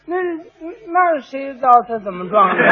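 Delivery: halting, fumbling excuse; cornered stammering. Acted. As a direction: stumble over the first words, unsure. Cornered rider fumbles for an answer.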